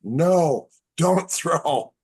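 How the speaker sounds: high, loud mock alarm from onlookers; alarmed shout. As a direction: shout the warning like a crowd in a panic. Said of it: "And everyone goes".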